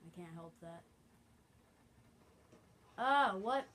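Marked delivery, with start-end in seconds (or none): 3.0-3.6 loud, higher, rising disbelief; startled outburst. Real, not acted. Player caught out in the game, startled outburst. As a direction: yelp it as a baffled question.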